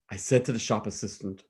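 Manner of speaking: usual speaking voice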